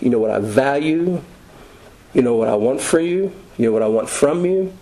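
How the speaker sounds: ordinary talk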